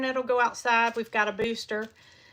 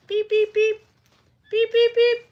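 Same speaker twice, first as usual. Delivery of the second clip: high, louder beeping like a vehicle horn; imitating a horn; 11 semitones higher, 8 dB louder than usual. Shown as a demonstration. Copying how the side by side sounds, high beeps. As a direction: chirp the beeps like a little horn. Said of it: "because that's what it sounds like to me".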